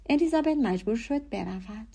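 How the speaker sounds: plain reading voice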